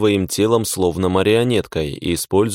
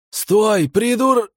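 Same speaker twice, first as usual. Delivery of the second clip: shouting; 14 semitones higher, much slower than usual. A narrator's reading. Mages cry out as he jumps.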